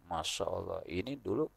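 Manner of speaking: low, soft imitation of a tattler; mock gossiping. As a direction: voice a gossip under the breath, lightly. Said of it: "Ada omongan lagi".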